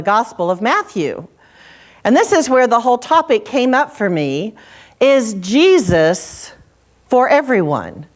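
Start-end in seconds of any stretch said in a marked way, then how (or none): 5.0-8.0 very slow, with a long pause after Jesus; slow pondering question. Shown as a demonstration. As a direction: let each word hang, drawn out and searching. Preacher repeats the question twice.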